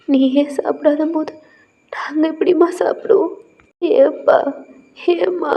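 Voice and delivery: put-on a parent near breaking, begging their child; tearful parent. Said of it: "கண்ணுல tears வந்துக்கிட்டே இருக்கு". (கண்ணீருடன் உரக்கக் கெஞ்சும் பெற்றோர்)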